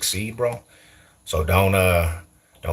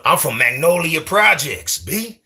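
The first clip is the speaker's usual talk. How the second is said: high, hammed-up New Orleans projects accent; mock Magnolia Projects accent; 8 semitones higher, slower than usual. Shown as a demonstration. Streamer teasing viewers tripping on accents, mock New Orleans accent. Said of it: "Now, what if I talk like this?".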